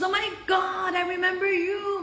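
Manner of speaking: high, imitated gush of excitement; delighted recognition. Woman recognizing the rabbi's daughter, excited.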